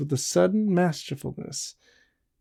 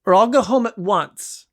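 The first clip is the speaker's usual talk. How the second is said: a raised, higher warning; commanding threat; 7 semitones higher, 4 dB louder than usual. A narrator's reading. Woman warns her suitor she will leave, raised firm voice. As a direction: make the threat stick. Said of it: "she commanded".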